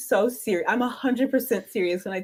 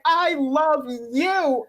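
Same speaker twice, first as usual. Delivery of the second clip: slow, drawn-out higher declaration; gushing adoration; 4 semitones higher, much slower than usual. Retold or imitated. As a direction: stretch every word out adoringly. Retold gushing reply to beloved character.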